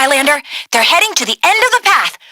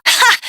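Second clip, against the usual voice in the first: short laugh; 6 semitones higher than usual. Acted. Laugh at armored ships joke.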